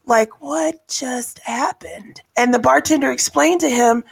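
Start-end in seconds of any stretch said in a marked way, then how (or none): 0.4-2.2 hushed, slow, imitated disbelief; stunned near-whisper. Stunned bar patron reacting to the outburst, hushed and slow.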